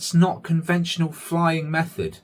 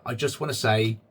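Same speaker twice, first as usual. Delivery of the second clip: ordinary talk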